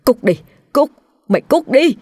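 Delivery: high panicked yell; screaming in terror. A narrator's reading. Terrified father screams at the ghost in bed, high panicked yell. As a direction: scream to drive the ghost away. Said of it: "rồi la hét lên".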